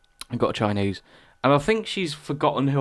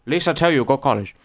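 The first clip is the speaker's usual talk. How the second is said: mock Chinese accent; 3 semitones higher, 4 dB louder than usual. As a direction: speak as the shop lady in a Chinese accent. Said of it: "she just said".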